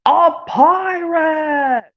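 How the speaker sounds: high, long-held cry of alarm; alarmed cartoon cry. Cartoon character spotting a pirate, alarmed cry. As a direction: cry it out, stretching the last word.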